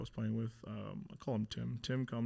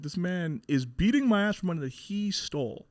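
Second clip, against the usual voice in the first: loud, high indignant protest; retold outrage; 7 semitones higher, 8 dB louder than usual. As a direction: raise pitch and volume in disbelief at the injustice.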